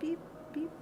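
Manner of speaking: tiny high whispered beeps. Pretend. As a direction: whisper it like a little robot. Playful whispered noise.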